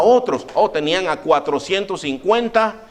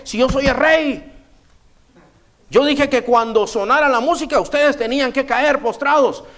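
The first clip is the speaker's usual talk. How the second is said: much higher, raised angry challenge; furious king; 9 semitones higher, 3 dB louder than usual. Retold or imitated. Furious king confronting the three young men, high angry challenge. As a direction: demand an answer like an outraged king.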